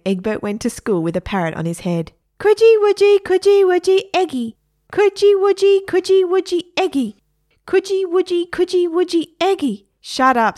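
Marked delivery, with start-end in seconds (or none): 2.4-9.9 very high, loud parrot chant; teasing parrot. Acted. Parrot pestering boy at school, high squawk. 10.0-10.6 put-on loud, irritated boy; Egbert. Boy to parrot on his head, loud irritation. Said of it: "said Egbert".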